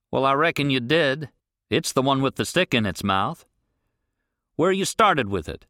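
gruff rancher. Acted. Rancher questions the boy on the road, irritated. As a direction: demand an answer, irritated.

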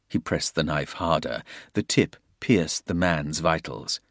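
ordinary delivery

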